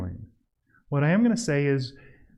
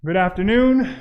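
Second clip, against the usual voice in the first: demo greeting; 5 semitones higher, 5 dB louder than usual. Shown as a demonstration. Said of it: "talk slowly".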